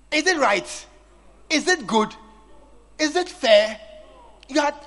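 loud, rising rhetorical questions; preaching rebuke. Real, not acted. Preacher scolding phone users in church, loud rebuke. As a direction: call them out with force.

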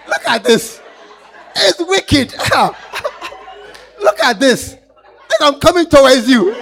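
loud laughing retelling. Real, not acted. Preacher laughing while mocking the wicked nurses. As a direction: laugh through every word.

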